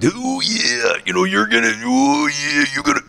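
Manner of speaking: high, strained wrestler impression; put-on wrestling promo voice; Randy Macho Man. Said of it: "Randy Macho Man".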